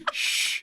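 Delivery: unvoiced hushing sound; library shush. Real, not acted. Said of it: "We're recording in a library".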